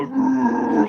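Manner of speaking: high playful roar; mock roar. Yelling a roar behind the camera. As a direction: roar like a monster in the background. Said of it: "You can hear me go".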